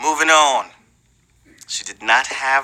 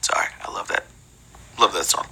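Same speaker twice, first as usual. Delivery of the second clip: whispered aside; 9 semitones lower, 7 dB quieter, faster than usual. Real, not acted.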